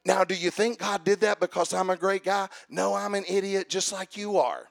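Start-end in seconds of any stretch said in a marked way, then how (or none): none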